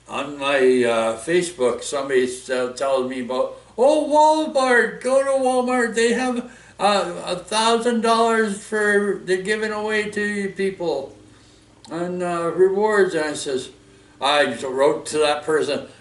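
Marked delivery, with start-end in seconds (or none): 3.8-13.1 high, loud mocking impersonation; mimicked online tipster. Vlogger imitates Facebook user pushing Walmart rewards, high mocking voice. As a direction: mimic someone gushing in a raised pitch.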